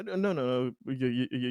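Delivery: usual speaking voice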